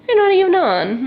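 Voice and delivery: high, loud disbelief; surprised disbelief. Real, not acted. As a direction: sound genuinely surprised they're missing. Can't find her favourite people.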